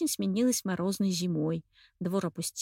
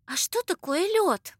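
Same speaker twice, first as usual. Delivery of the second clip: surprised; 9 semitones higher, 3 dB louder, slower than usual. A narrator's reading. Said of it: "удивлённо спросила Анечка".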